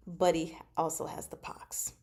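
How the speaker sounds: hushed reveal of bad news; dramatic whisper. Real, not acted. Recapping a novel to viewers, whispering the grim reveal. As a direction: drop to a whisper for the twist.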